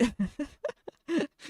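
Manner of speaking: giggling laugh. Real, not acted. Streamer laughs at own joke.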